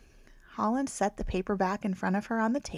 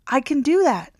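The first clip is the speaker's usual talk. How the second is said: calling after her; 5 semitones higher, 9 dB louder than usual. Acted. Librarian calls after her.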